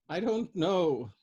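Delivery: put-on high, slow tearful old woman; sobbing. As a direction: choke it out through tears, slowly. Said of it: "sobbed the old woman".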